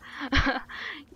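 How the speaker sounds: loud startled laugh after a jump scare; nervous laugh. Real, not acted. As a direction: laugh off the fright, loudly. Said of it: "Il va me faire faire une crise cardiaque".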